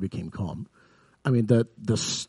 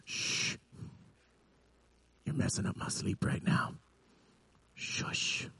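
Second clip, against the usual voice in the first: sleepy whispered shushing; 11 dB quieter than usual. Retold or imitated. Jesus woken in the boat shushes the waves, whispering. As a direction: hush them, half asleep.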